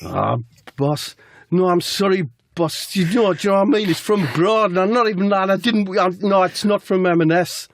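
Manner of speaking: high-pitched flustered excuse; hammed-up pleading player; Jack. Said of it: "Jack says".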